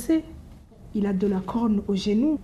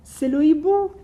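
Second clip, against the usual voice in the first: higher, louder announcement; excited announcement; 7 semitones higher, 7 dB louder than usual. Acted. The mouse announces the owl to the gruffalo, higher and louder. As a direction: call it out triumphantly.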